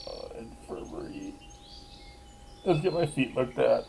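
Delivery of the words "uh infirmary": breathy whisper to himself; hushed mumble; whispered aside. Real, not acted. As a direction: drop to a whisper for the choice. Muttered choice aside.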